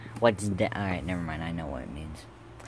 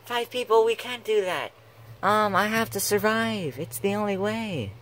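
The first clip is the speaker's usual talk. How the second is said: put-on high-pitched British accent; 9 semitones higher than usual. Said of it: "Wait, you're British now?".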